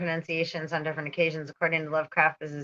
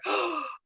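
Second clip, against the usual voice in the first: mock gasp.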